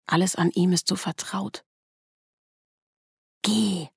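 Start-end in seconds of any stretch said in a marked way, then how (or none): 3.4-3.9 higher-pitched urging without volume; restrained mock shout; mild inner cry. A narrator's reading. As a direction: urge it sharply, but keep it contained. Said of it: "schreit die Stimme in meinem Kopf".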